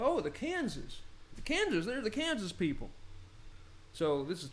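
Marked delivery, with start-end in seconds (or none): 0.0-2.9 high, swooping mock Southern accent. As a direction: mishear it in an exaggerated Southern accent. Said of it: "the Southern, the ones with the accents".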